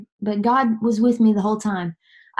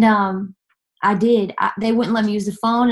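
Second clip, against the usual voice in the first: usual speaking voice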